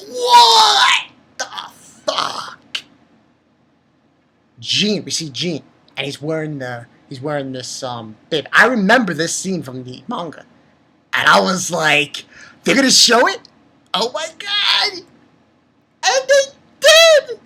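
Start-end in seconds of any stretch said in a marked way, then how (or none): 0.1-2.8 high, loud cry dropping to a voiceless curse; shocked outburst. Retold or imitated. Retelling shocked reaction to episode. 12.6-17.4 astonished, voice climbing very high; shocked disbelief. Retold or imitated. Acting out shock at the scene.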